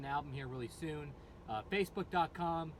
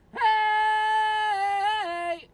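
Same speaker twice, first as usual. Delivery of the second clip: very high, loud held scream; high-pitched held scream; 15 dB louder than usual. Shown as a demonstration. Screaming coach demoing a long high scream. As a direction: hold it as high as you can go. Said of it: "Get a high-pitched noise, high as you can go".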